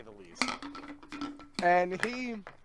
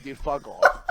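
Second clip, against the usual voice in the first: retold snap back; 2 semitones lower, 11 dB louder, slower than usual. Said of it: "And I'm like".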